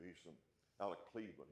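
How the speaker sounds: ordinary talk, nothing marked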